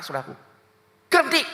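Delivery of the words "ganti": high, loud retold bark; angry demand. Car owner demanding compensation from becak driver, acted angry shout. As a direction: act out a furious demand for payment. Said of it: "Saya marah".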